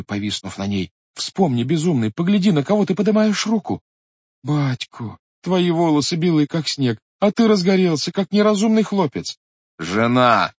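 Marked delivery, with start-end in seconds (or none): 1.1-9.4 put-on high, pleading cry of a young wife; wife crying out to her father, high and urgent; Katerina crying out. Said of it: "прокричала громко Катерина". 9.8-10.5 sharp, commanding call to his wife; husband cutting off his wife with a stern shout. Acted. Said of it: "крикнул грозно пан Данило".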